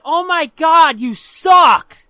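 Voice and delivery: loud jeering heckle; heckling. Retold or imitated. Kid behind the backstop at other kids, loud heckling. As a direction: heckle the players at full voice. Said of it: "But I'm heckling".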